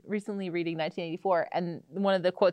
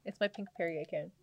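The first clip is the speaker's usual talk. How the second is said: usual speaking voice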